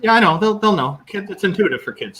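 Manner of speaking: high-pitched, insistent comeback; retold insistence. Retold answer to doubting wife.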